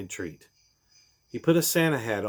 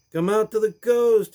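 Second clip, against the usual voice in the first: much higher, louder sarcastic quote; mocking sigh; 12 semitones higher, 8 dB louder than usual. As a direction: sing it out mockingly. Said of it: "he sighed".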